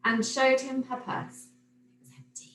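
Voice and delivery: usual speaking voice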